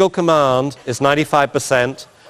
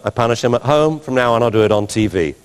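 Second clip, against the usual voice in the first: ordinary delivery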